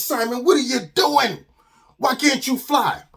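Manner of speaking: exasperated father. Acted. Father to son who fell back down, exasperated. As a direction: demand an answer from a failing son.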